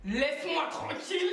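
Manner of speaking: high mock snap, breaking into laughter; retold irritated outburst. Woman in labour to her partner, mock irritated outburst. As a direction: push him away, amused at yourself.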